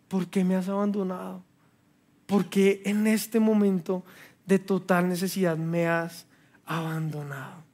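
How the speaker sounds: soft, slowing anguished question to God; a hurt believer asks God why; anguished plea. Retold or imitated. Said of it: "Y así me sentía yo".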